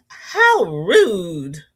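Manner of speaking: mock-offended, in Jen Reeves' manner; Jen Reeves impression. Host channelling her friend Jen Reeves at the falling cup, mock-indignant. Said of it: "channel my inner Jen Reeves".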